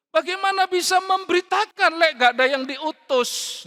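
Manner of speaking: loud, high rhetorical question; forceful preaching. Acted. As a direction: drive the question home at full voice. Preacher hammering a quoted scripture question.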